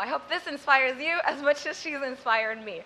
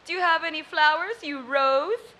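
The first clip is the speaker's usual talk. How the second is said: flirting playboy line; 4 semitones higher, 5 dB louder than usual. Acted. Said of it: "He walks up to a woman".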